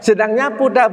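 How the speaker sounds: ordinary talk, nothing marked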